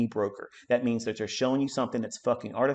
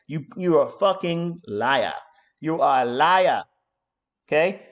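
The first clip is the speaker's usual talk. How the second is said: usual speaking voice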